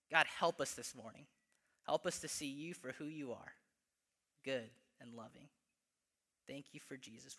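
unhurried spoken prayer that softens toward the end. Acted. Pastor praying for the church in a gentle prayer voice.

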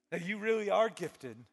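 normal talking voice

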